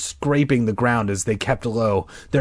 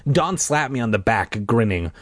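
ordinary delivery

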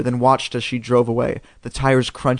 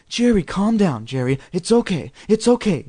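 comforting mom; 6 semitones higher than usual. Acted. Mom comforting panicked son.

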